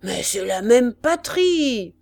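loud, drawn-out protest; exasperated shout. A narrator's reading. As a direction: shout it slowly to drive it home. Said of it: "cria le sergent". (cri indigné)

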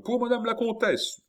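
high, loud delivery call. A narrator's reading. Porter quoting the woman with the box, calling out. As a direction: call it through a door. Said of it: "m'a crié".

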